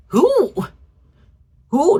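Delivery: high, loud incredulous "Who?"; stunned disbelief at the claim. Real, not acted. Outraged disbelief at the story.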